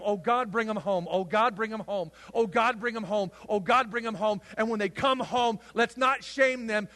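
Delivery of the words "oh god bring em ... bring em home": higher, louder repeated plea; pleading prayer. Shown as a demonstration. Modelling prayer for lost people, pleading. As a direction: pray it over and over. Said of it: "to pray for them".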